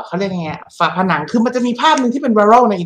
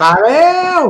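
mock alarm; 9 semitones higher, 3 dB louder than usual. Real, not acted. Mock alarm at missing king.

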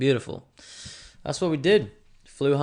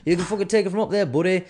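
fast, higher, louder cocky brush-off; punchier, quicker repeat of the pundit impression; Michael Bisping impression; 8 semitones higher, 5 dB louder, faster than usual. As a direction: rattle it off like a swaggering fighter. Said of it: "that's how he talks".

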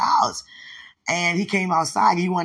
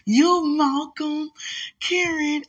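1 excited; 11 semitones higher, slower than usual. Real, not acted. Naming people in photo excitedly.

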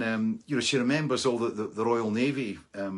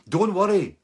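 high, loud mock reassurance; acted shout of reassurance; overdone reassurance; 9 semitones higher, 9 dB louder than usual. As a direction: call it out far too loudly to a nervous stranger. Imagined reassurance to a wary stranger.

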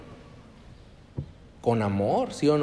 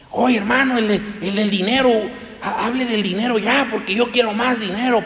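higher, louder pleading for money; greedy believer; 9 semitones higher, 5 dB louder than usual. Pretend. Believer nagging the preacher about money, loud and higher. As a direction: demand more money, pushy. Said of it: "Santiago te exhorta, dice, "Hey".

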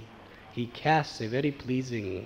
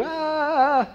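high mock wail of a crying child; child's wail; 5 dB louder than usual. Girl crying as she is held over the lake, high wail. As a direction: let out a little girl's cry. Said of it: "she started crying".

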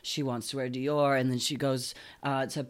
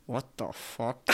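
low, soft, through a fixed smile; hushed smile-and-talk aside; smiling through teeth; 5 semitones lower, 8 dB quieter, slower than usual. Shown as a demonstration. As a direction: keep the teeth shut and murmur it. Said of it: "I literally will smile and I go".

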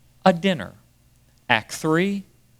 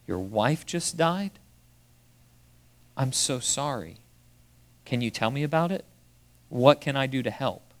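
compassionate reply; 2 semitones lower, 7 dB quieter than usual. Retold or imitated. Covey's compassionate reply retold.

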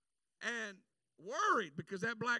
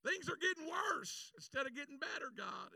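a whining why-me prayer; pleading complaint; 7 semitones higher, 3 dB louder than usual. Retold or imitated. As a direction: reproach heaven from the roadside. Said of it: "I'm sitting here going".

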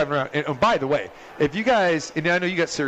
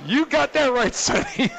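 mock announcer; 9 semitones higher than usual. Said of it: "the announcer says".

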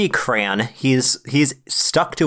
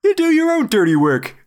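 mock Satan reply; 14 semitones higher than usual. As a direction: pitch it way up as a comic devil. Acts Satan answering the prayer.

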